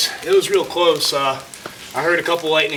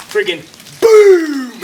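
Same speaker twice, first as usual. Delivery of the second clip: loud boom; 11 semitones higher, 8 dB louder, slower than usual. Retold or imitated. Retelling a tree crashing down.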